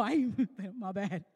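amused laughing aside. Acted. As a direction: chuckle through the line. Peter reacts, laughing.

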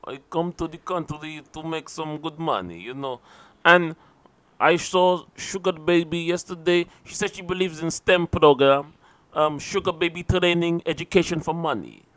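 soft put-on Eastern European accent; mock Eastern Bloc accent. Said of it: "definitely like an Eastern Bloc".

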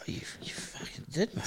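breathy whisper, leaning in close. Retold or imitated. Boss whispering to Duncan as the tape plays, hushed whisper. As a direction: hiss it under your breath so nobody else hears. Boss leans over in meeting.

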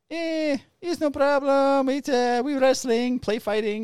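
put-on high, slow, lilting accented voice; mock Spanish accent. Cop imitating the men explaining the fight. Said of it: "they're like".

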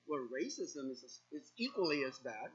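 quiet plain aside. Shown as a demonstration. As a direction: drop back to plain calm. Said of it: "selective indignance".

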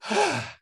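a high, audible sigh pushing air out; demo sigh. Shown as a demonstration. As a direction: let the breath out in a big sigh. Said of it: "so you go".

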